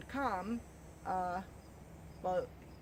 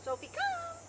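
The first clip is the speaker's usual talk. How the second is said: calling the dog. Real, not acted. Dog training recall call.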